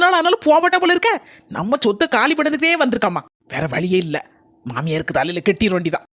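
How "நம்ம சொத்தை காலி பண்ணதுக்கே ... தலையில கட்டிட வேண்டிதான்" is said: normal talking voice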